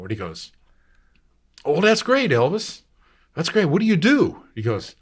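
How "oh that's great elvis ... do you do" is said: high, loud delighted shopkeeper. Retold or imitated. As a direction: gush over the boy's news. Shopkeeper voiced in story.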